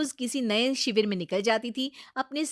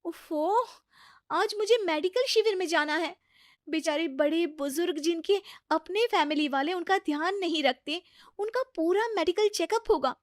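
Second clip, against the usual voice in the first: impatient dismissal; 6 semitones higher than usual. Acted. Priti brushes off sick father-in-law.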